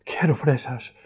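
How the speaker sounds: put-on a worn-out boy pushing his demand; mock exhausted wail; tired tantrum. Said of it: "le empiezan a fallar las fuerzas".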